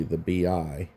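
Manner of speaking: ordinary talk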